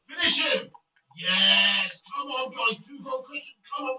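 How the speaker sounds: loud, high cheer; excited shout. Real, not acted. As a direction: yell it as the goal goes in. Goal scored in match.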